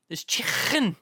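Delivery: high, exaggerated Scouse with a scraped throaty k; mock Mum's Scouse "chicken"; hammed-up Scouse mother; mum's Scouse accent. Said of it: "a bit of Scouse from my mum".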